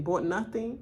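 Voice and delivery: normal talking voice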